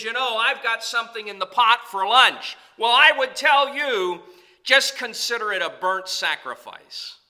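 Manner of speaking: loud, driving preacher voice; fiery preaching. Acted. Pastor rouses the congregation, fiery preaching. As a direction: preach it with rising fire.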